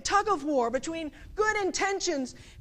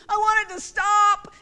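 loud, higher, insistent outburst; desperate demand; 5 semitones higher, 8 dB louder than usual. Acted. Parent with a crying baby, desperate demand. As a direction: belt it out as the fed-up part. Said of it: "there's two parts of me".

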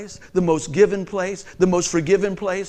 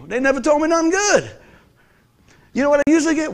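ordinary talk, nothing marked

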